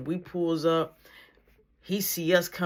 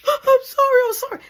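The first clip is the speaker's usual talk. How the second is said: high, loud mock wailing apology; imitated crying plea; 11 dB louder than usual. Little brother begging mom mid whipping, mock wail. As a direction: wail it like a kid getting whipped.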